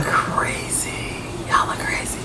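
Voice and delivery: muttered under the breath; whisper. Real, not acted. Reactor whispers to herself about the girlfriend. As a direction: whisper it to yourself. Muttered aside while watching.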